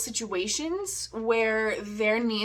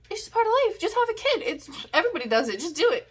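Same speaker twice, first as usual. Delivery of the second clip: mocking voice, much higher; squeaky mockery; 8 semitones higher, much faster than usual. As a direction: squeak it out like a dismissive know-it-all. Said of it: "everybody is just like".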